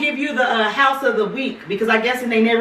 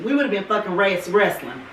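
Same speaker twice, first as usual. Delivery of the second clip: ordinary talk, nothing marked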